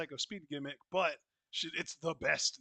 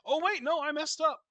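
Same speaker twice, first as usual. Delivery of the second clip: high, louder flustered self-correction; 12 semitones higher, 6 dB louder than usual. Real, not acted. Co-host catching own timestamp mistake, flustered.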